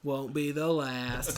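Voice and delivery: slow, knowing low growl of a line; acted boast. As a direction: drawl it low as an old rogue. Acting Shipwreck's brig boast.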